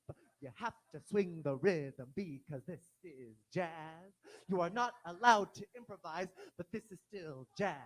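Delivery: loud, emphatic teacher counting the beat; mock conductor teaching jazz, punching key words; classical orchestra conductor. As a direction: stiffly drill a class on swing. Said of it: "your high school's classical orchestra conductor".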